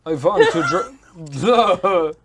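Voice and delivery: loud laughing vampire blah; hissing feral vampire. Pretend. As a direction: ham up a cackling vampire.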